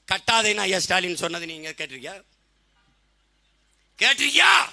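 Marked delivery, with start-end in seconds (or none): 4.0-4.7 shouted repeat. Real, not acted. Orator repeats his question to the crowd, shouting. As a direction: fire the question back, much higher and louder.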